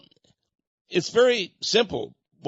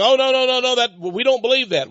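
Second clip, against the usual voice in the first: higher, louder, imitating a flustered protest; retold flustered denial; 9 semitones higher, 5 dB louder than usual. Angry man at the conference, insisting to the preacher, loud and high. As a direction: act out his frantic denial, voice up and loud.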